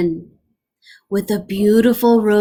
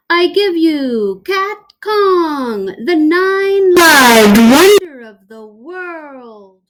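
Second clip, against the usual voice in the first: higher, louder, slow grand announcement; squeaky showman; 6 semitones higher, 7 dB louder than usual. Acted. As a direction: present the prize to the crowd. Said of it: "he squeaked".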